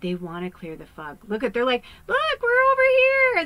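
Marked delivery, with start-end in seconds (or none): 2.1-3.4 much higher, louder sing-song call from afar; spirits calling. Retold or imitated. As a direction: call like spirits beckoning across the fog. Spirits voiced calling to her.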